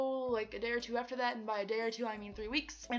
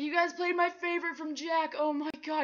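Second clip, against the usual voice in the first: excited gush; 7 semitones higher, 4 dB louder than usual. Retold or imitated. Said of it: "I was like".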